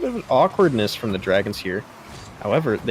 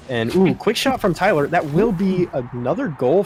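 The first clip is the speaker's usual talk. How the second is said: excited; 5 semitones higher, 4 dB louder than usual. Real, not acted. Goal scored.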